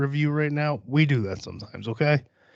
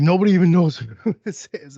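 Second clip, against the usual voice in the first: raised, louder mock outrage breaking into a laugh; mocking imitation; 4 semitones higher, 6 dB louder than usual. Host imitating freaked-out rap fans, mocking and laughing. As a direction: mimic panicked commenters, laughing as you go.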